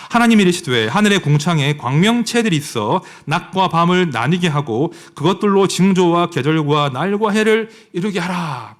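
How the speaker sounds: loud, measured Bible reading from the pulpit; a preacher declaiming scripture to the congregation; solemn scripture reading. Acted. Reads Genesis 1 aloud.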